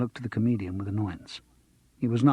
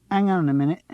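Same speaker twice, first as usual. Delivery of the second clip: Cockney; 6 semitones higher, 4 dB louder than usual. Acted. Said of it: "They were all Cockney".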